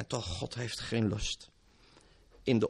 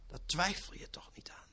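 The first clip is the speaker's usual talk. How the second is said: whispered question; 5 semitones higher, 10 dB quieter than usual. Acted. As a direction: whisper "Daar twijfel je toch niet aan?" much softer, rising at the end.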